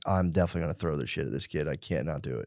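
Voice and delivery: low, soft aside to oneself; muttered resolve. Retold or imitated. Kid at school plotting trouble, quiet muttering. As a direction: mutter it darkly under the breath.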